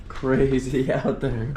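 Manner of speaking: slow words into a long laugh. Real, not acted. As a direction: crack up at the view. Laughing at the storm.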